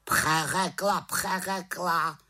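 high raven echo, slowing down; high-pitched bird repeating it; croaking raven. A narrator's reading. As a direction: croak the word back mockingly. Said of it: "krákal havran".

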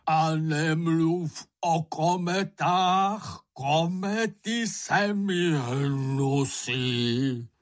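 slow, high hysterical wailing; hysterical cry. A narrator's reading. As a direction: drag each word into a hysterical howl. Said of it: "vykřikl Bambul hystericky". (hystericky, táhle kvílet)

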